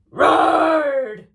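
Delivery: very loud, high, drawn-out roar. A narrator's reading. Storyteller as the fallen dragon faces crowd, roaring.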